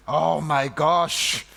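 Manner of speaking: loud, slow "Oh my gosh"; mock astonishment. Recalling the confusing greeting with loud mock astonishment. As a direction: draw out the disbelief.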